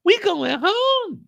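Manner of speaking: long for home; Tasha hopeful. Retold or imitated. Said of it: "Tasha talking about".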